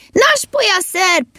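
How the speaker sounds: high, drawn-out call; blaring shout. A narrator's reading. Elf shouting drink ideas from the cart, high blaring call. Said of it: "és egyre harsogott".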